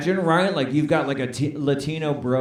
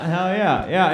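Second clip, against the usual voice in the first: normal talking voice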